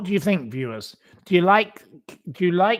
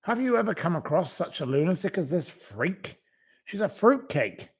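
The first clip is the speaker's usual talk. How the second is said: normal talking voice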